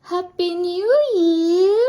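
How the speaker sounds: loud, high, stretched-out cheer; festive greeting. Real, not acted. Greeting viewers on New Year, festive and drawn out.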